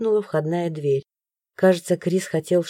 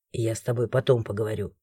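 low, quiet menacing; cold man; 10 semitones lower, 7 dB quieter than usual. A narrator's reading. Chris to the heroine, low quiet threat. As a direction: promise a talk later.

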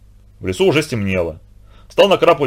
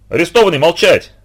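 playful barked command, a bit louder; mock stern order; 6 semitones higher, 4 dB louder than usual. A narrator's reading. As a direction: hush the prisoner in mock sternness. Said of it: "приказал Владимир Сергеевич".

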